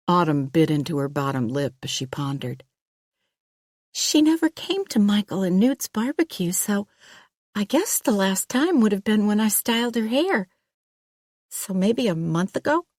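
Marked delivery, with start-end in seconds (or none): 3.9-12.9 put-on higher, hesitant young woman voice; an uneasy hairdresser, caught off guard; nervous young woman. Hairdresser recalls.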